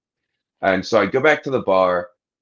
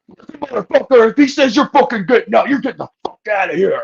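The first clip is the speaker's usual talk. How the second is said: angry bartender yelling; 12 semitones higher than usual. Retold or imitated. Bartender throwing a customer out, in a high furious yell. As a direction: bark him out of the bar, pitched way up.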